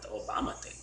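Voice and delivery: unvoiced whisper of surprise; incredulous whisper; whispered disbelief. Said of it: "And I was like".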